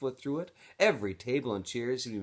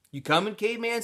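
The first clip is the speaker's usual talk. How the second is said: much higher, louder call across the room; 13 semitones higher, 7 dB louder than usual. Acted. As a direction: call him over to dinner. Squid calling Stanley.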